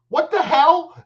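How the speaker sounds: high, loud mock-startled yelp; breaking into a laugh on a shocked shout; startled outcry. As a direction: act out being caught off guard, loud and high. Acting out startled reaction to commercial.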